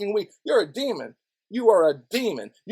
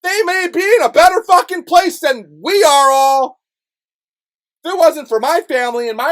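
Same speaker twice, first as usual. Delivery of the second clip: loud, higher ranting shout; 7 semitones higher, 8 dB louder than usual. Real, not acted. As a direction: yell it out in frustration. Angry rant.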